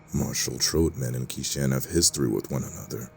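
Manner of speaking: plain reading voice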